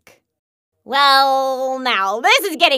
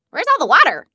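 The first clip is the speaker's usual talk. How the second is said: ordinary delivery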